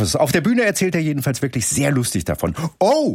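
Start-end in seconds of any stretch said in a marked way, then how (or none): 2.8-3.1 sudden high startled oh; surprised. Real, not acted. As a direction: caught off guard by a jingle. Jingle interrupts.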